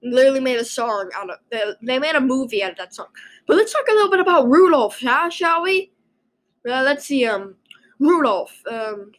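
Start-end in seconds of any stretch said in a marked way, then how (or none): 3.5-5.9 excited. Real, not acted. Perky switch to Rudolph topic.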